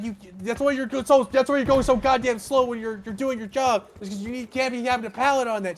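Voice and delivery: high loud nagging yell; mock yelling. Coworker yelling from platform at driver, high loud mock yell. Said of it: "He's like".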